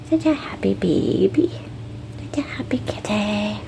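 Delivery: ordinary talk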